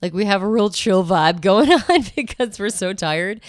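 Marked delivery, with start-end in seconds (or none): none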